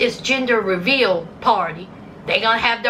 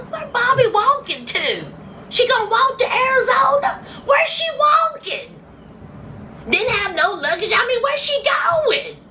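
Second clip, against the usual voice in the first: incredulous; 12 semitones higher than usual. Real, not acted. Vlogger mocking the edit, shouting high and incredulous. Mocking the poorly edited walking scene.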